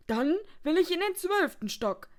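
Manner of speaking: high, loud, determined guest; insistent demand. A narrator's reading. Fed-up guest telling the receptionist, insistent.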